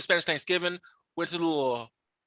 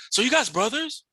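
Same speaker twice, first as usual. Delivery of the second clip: higher, rising imitation of a silly question; naive questioner; 6 semitones higher than usual. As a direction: lightly mimic someone clueless asking. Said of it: "ask me stupid questions".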